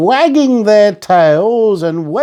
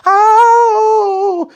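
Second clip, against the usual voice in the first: high, loud startled cry; sudden fright at the window; frightened scream; 4 dB louder than usual. A narrator's reading. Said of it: "she screamed".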